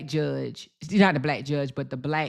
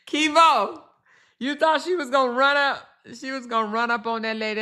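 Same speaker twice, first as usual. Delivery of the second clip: high playful disbelief, quick at the end; squealed gossip reply; high excited teasing; 9 semitones higher than usual. Real, not acted. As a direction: call out a viewer, high and amused. Teasing a viewer by name.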